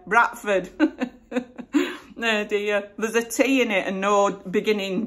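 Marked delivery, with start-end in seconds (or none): 0.1-0.7 Bradford said as Bratford, hard T, no D at the start; Yorkshire Bradford. Shown as a demonstration. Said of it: "that's how we say it here".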